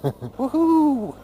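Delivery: high, gleeful woohoo after a laugh. Real, not acted. Rider thrilled after the descent, gleeful woohoo. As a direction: cheer with delight.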